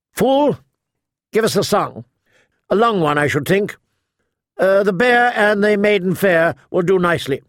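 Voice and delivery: high, imperious call. A narrator's reading. Old lady ordering her jester to sing, calling out. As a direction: summon the fool from across the room. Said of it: "The old woman called to Butterbumps".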